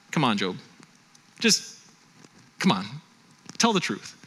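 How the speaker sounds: low, halting coaxing with long pauses; acted needling friend, understated; prodding. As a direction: nudge a friend toward a confession, bit by bit. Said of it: "continue to prod him and needle him".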